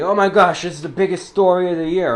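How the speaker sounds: loud, high gushing over big news; mock excitement. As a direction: gush like it's huge news.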